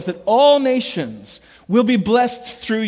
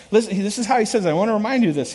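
ordinary delivery

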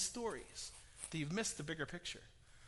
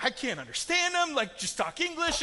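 frustrated viewer; 8 semitones higher, 5 dB louder than usual. Retold or imitated. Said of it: "And you're like".

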